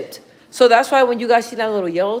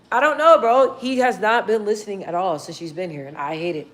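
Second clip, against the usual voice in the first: usual speaking voice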